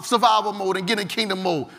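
ordinary talk, nothing marked

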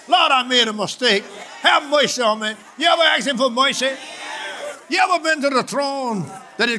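rising, shouted sermon voice; fervent preaching. Acted. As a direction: preach it with the whole church behind you. Preacher at full force.

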